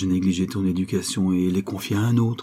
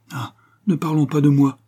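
raised, louder protest; exclaiming; 6 semitones higher, 5 dB louder than usual. A narrator's reading. Diane turning talk away from her marriage, exclaiming. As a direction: burst out, brushing the subject aside. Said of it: "s'écria Diane".

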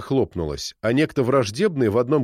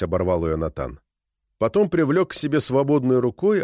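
usual speaking voice

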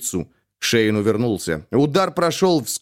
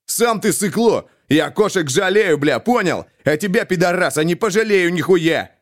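furious retort; 6 semitones higher than usual. A narrator's reading. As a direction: hurl the insult right back. Shein jumps up, furious.

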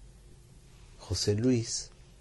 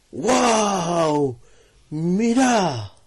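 higher, louder excited wow; excited exclamation; 8 semitones higher, 5 dB louder, much slower than usual. Acted. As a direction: call out the discovery with wonder. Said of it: "Anastasio le dijo".

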